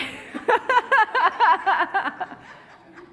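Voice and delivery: high bursting laughter. Real, not acted. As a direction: laugh out freely. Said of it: "You're laughing".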